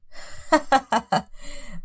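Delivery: short breathy scoffing laugh; scornful laugh. Acted. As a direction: laugh her off with contempt. Husband scoffs at his wife.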